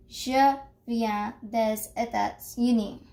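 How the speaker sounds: "Je viens des États-Unis" said slowly, syllable by syllable; slow, careful "Je viens des États-Unis"; slow model sentence. Shown as a demonstration. Teacher has class repeat "Je viens des États-Unis" slowly. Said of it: "let's pronounce it again".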